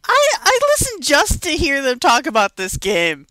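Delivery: loud, high mock protest; complaining listener. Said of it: "other listeners are probably going".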